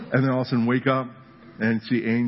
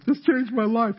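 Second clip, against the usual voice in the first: much higher, swept-up testimony line; testimony rapture; 10 semitones higher than usual. Retold or imitated. Testifier to the congregation, high and swept up. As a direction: gush like a convert testifying. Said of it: "It says".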